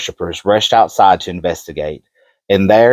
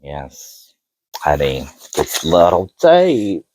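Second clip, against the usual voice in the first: sultry voice; 3 semitones lower, slower than usual. Pretend. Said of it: "my sultry voice on for them".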